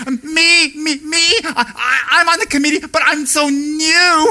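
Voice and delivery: high, loud excited squeal; gushing. Retold or imitated. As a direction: squeal with giddy delight. New member gushing.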